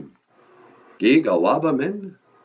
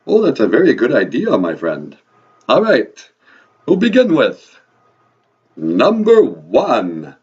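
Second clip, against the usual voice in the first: put-on loud, theatrical vampire accent; Count; 6 dB louder than usual. Count agreeing to teach counting with the host, booming vampire accent. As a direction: swoop up and down in a booming Transylvanian accent. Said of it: "your vampire accent".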